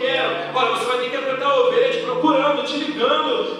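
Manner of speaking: impassioned pulpit shouting; full-force preaching. Acted. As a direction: preach it out with rising fire to the congregation.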